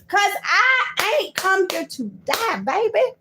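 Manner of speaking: high outcry. Real, not acted.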